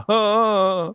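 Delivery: high sharp gasp of shock; startled gasp. Retold or imitated. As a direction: gasp in surprise. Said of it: "And I'm like".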